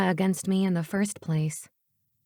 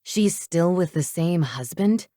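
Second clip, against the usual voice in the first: plain reading voice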